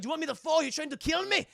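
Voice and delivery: loud, high Scottish bluster; hammed-up indignant Scot; protesting Scotsman. Scotsman refusing to move over for Jack, indignant.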